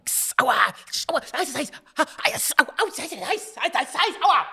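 high yelps with a mouth full of hot food. Pretend. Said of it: "Der ist kochend heiß".

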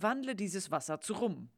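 ordinary talk, nothing marked

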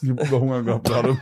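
chewing through the words; eating cereal. Real, not acted. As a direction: mouth full, half laughing. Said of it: "Sorry, ich esse gerade".

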